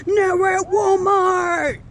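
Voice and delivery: loud, slow, high exclaim; giddy arrival cheer; excited shout. Real, not acted. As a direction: announce it with a thrilled yell. Excited reveal of Walmart trip.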